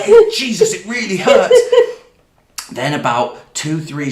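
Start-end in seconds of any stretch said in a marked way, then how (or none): 0.0-1.6 higher, laughing as you plead; half-laughing mock protest of pain; laughing complaint. Retelling begging the doctor during stitches, laughing.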